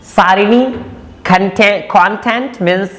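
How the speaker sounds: ordinary delivery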